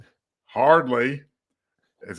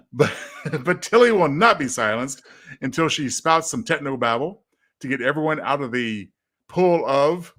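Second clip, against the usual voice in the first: normal talking voice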